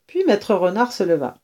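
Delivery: ordinary delivery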